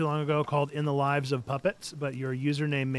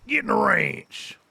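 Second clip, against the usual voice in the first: higher, louder drawn-out command; mock herding command; 7 semitones higher, 5 dB louder, slower than usual. Gamer ordering animals into the ranch, mock bossy.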